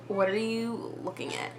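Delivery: hushed inner voice, barely voiced; inner thought. Teen at the store thinks it quietly to herself. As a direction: think it, half under the breath. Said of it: "thinking in my head".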